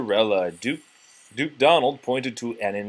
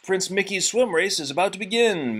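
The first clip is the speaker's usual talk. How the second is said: announcing; 8 semitones higher than usual. A narrator's reading. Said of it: "Sir Goofy announced".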